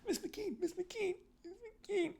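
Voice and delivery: put-on high, soft pleading kid voice; pleading child. Kindergartner pleading with the teacher, high and soft. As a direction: call the teacher softly, begging. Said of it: "I'm just like".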